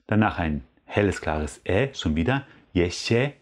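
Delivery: ordinary talk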